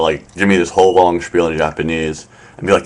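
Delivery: normal talking voice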